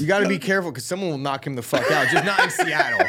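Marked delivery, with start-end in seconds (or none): 2.1-3.1 higher and louder, laughing through it; laughing punchline. Acted. Joking to the guys about Seattle, laughing and louder. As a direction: sell the joke with a laugh.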